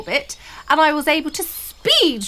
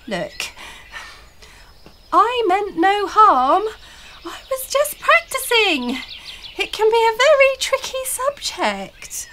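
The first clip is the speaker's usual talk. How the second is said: much higher, anxious little voice; pleading fairy; 10 semitones higher than usual. A narrator's reading. Blossom explaining herself to angry trolls, pleading. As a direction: plead innocence in a high voice.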